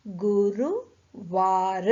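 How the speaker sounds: गुरुवार drawn out slowly, syllable by syllable; slow teacher model of गुरुवार; slow syllable reading. Shown as a demonstration. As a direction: stretch गुरुवार slowly for the class. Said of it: "इन शब्दों को पढ़ें।".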